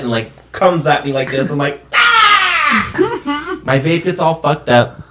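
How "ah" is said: high squealed scream, held back in volume; mock scream. Rider in the buggy as it floors it through a puddle, mock scream. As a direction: squeal as if water is coming at you.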